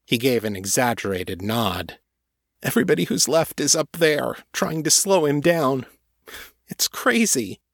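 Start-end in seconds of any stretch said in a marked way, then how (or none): 2.6-7.6 put-on higher, fast, panicky kid; frightened young man. A narrator's reading. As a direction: rush it out in fear. Frightened cultist voiced.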